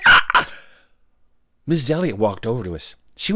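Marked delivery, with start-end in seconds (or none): 0.1-0.5 short, sharp cackling cry; imitated wicked witch laugh; punchy witchy cackle. Witch ends her threat.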